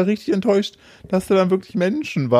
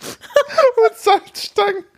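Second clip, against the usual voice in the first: laughing; much slower than usual. Real, not acted. Cracking up mid-joke.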